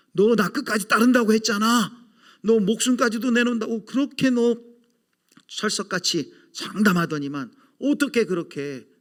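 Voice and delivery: high, loud scolding of a disciple who broke his promise; angry confronting. Pretend. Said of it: "저 같으면 한번 따졌을 거예요".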